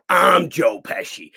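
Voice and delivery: slow, loud, emphatic declaration; acted-out cocky proclamation; defiant boast. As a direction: shout it slowly, hitting each word. Defiant boast after hanging up.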